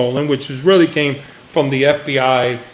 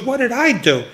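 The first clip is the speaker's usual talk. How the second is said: higher-pitched mock protest; protesting innocence; 7 semitones higher than usual. Man being reamed out answers back, mildly protesting innocence. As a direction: plead innocence in a retold quarrel.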